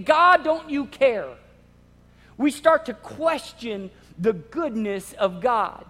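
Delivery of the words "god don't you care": slow, loud pleading cry to God; desperate cry. Retold or imitated. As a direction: beg God as if sinking. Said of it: "we're saying".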